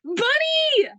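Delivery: high, drawn-out squeal at a pet; excited squeal. Real, not acted. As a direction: squeal it out with delight. Calls out excitedly to a bunny.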